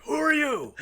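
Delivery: loud, high-pitched yelled demand; imitated stormy bellow. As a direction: burst in yelling like a wild boss. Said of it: "comes in like a storm".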